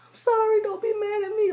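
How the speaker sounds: fake-cry your apology, hammed-up; mock crying plea. Begging her hair not to be mad, in a mock cry.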